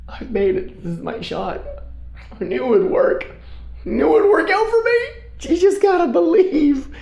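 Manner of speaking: rising to high excited squeals; giddy inner voice. Retold or imitated. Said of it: "I'm thinking".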